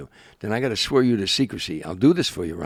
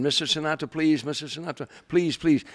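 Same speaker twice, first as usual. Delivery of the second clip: mock pleading yell; 7 semitones higher, 3 dB louder than usual. Fan begging [name] at the limo, mild mock yell. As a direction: retell a fan begging in a raised voice. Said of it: "she started screaming".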